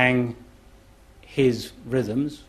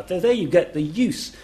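ordinary talk

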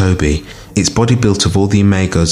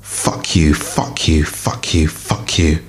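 repeated curse hissed out, softer; hissing curses; 4 dB quieter, slower than usual. A narrator's reading. Cornered creature curses Fuzzy, hissing and softer. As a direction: spit each curse out under your breath, softer. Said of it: "hissing, spitting madness".

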